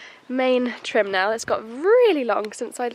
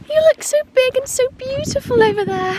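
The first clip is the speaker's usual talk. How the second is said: much higher, louder cooing; 5 dB louder than usual. Real, not acted. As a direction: call sweetly across the field. Cooing at pony in field.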